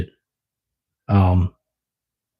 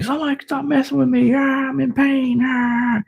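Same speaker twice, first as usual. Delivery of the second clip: very high, strained mock animal whine; pained pet voice; 4 dB quieter than usual. Owner voicing his hurt pet lashing out, mock pained. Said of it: "He was just like".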